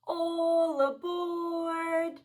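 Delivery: slow, high conductor call; train conductor. Pretend. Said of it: "my train whistle".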